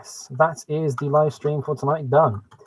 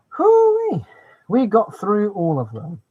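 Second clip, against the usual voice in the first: high cheering whoop; excited whoop; 8 semitones higher, 4 dB louder, slower than usual. Real, not acted. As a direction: whoop in triumph. Celebrates finishing the cars.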